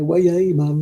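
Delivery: lightly imitated Geordie catchphrase; Geordie accent. Knitter quoting a Geordie saying on her stream, Geordie accent. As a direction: toss out a bit of Geordie. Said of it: "yeah, the Geordie".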